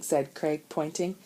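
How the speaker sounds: usual speaking voice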